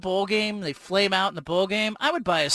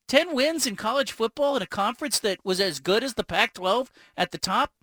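ordinary delivery

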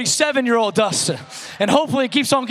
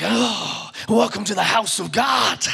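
preacher voice; slower than usual. Shown as a demonstration. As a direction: ham up a pulpit welcome in a big churchy voice. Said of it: "my voice would, like, completely change".

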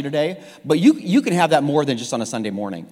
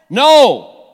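loud, higher mock-parent refusal; emphatic refusal; 6 semitones higher, 12 dB louder than usual. Preacher acting parents' knee-jerk response, loud and emphatic. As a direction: fire it out as an automatic parental veto.

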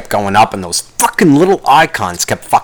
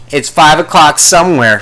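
catchphrase; 5 semitones higher, 5 dB louder than usual. Retold or imitated. Quoting a catchphrase.

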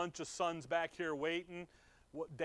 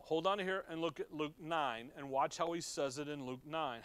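ordinary delivery

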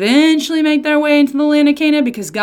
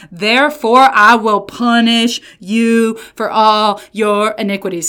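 slow, louder pronouncement; ominous verdict; 5 dB louder, slower than usual. Retold or imitated. God turning on his people with a slow loud verdict. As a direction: land the punishment heavily.

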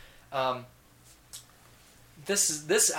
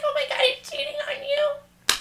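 much higher, louder, laughing through it; laughing shriek; 8 dB louder than usual. Retold or imitated. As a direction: squeal the news while cracking up. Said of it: "Voices were spot on".

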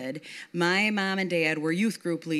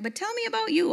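way higher, playful; sing-song question; faster than usual. Retold or imitated. Helen turning the talk back to Yvonne, much higher. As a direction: swing the pitch up high to turn the talk.

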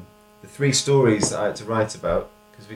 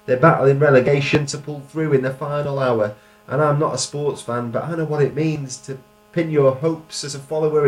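raised, rhythmic performance-poetry voice; higher-pitched spoken-word delivery; poem recital; 6 semitones higher, 7 dB louder than usual. Shown as a demonstration. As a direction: perform the verse with a driving lilt. Reads own poem aloud.

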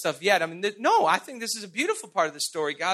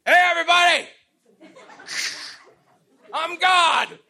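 booming mock shout from heaven, much higher and louder; mock God shouting; 8 semitones higher, 4 dB louder than usual. Preacher imitates God shouting from heaven at everyone. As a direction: bellow down like a heavy-handed God. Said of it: "God would just once a month just shout from heaven".

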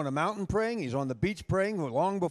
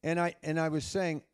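ordinary talk, nothing marked

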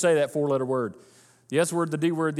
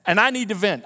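loud, insistent complaint, rising in pitch; hammed-up plea to the pastor; mock venting; 4 semitones higher, 5 dB louder than usual. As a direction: imitate someone demanding to be heard. Acting an insistent complaining churchgoer.

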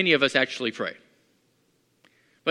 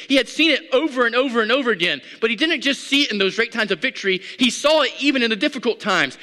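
higher, louder psalm read; full-force preaching; 6 semitones higher, 3 dB louder than usual. Real, not acted. Preacher proclaims the psalm again to the congregation, fired up. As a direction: preach the psalm with fire, fast.

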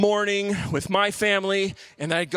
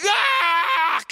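high sudden cry of pain; pained yell; 10 semitones higher, 4 dB louder than usual. Retold or imitated. Boy struck by two darts, high pained yell. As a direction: yelp as the dart hits.

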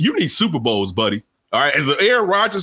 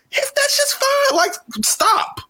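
high, incredulous plea to just stop; exasperated; slower than usual. Real, not acted. Host fed up with the voter, exasperated high stop. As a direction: throw up your hands in disbelief.

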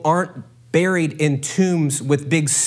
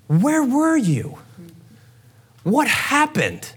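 slow, higher, wounded questions; hurt accusation; 9 semitones higher, slower than usual. Acted. Mary asks Jesus where he was, slow wounded questions. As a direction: drag out the disbelief. Mary's implied accusation voiced.

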